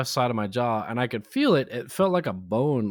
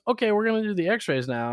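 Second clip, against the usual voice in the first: chirpy hygienist, higher, fast; 8 semitones higher, faster than usual. Retold or imitated. Hygienist voiced high fast.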